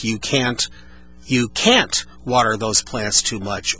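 "can't" hit hard, the rest slow; stress on CAN'T. Shown as a demonstration. Host showing emphasis on "can't" for a caller. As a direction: punch "can't" and let the rest follow. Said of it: "when I emphasize each word".

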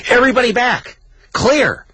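high-pitched emergency command; urgent medic call. Acted. Reader voicing medics working on dad, urgent call. Medics' calls acted in reading.

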